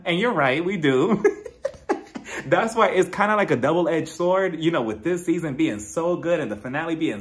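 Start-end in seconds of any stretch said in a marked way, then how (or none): 1.3-2.4 a quick airy burst of laughter; breathy high laugh. Real, not acted. Host cracks up at her own comment, laughing. Laughing at fans' toxic expectations.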